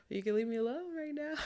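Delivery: small, high, softened mock plea. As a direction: plead softly and high, half joking. Retelling plea to be left alone.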